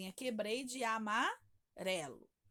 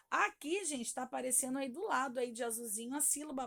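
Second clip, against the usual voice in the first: ordinary talk, nothing marked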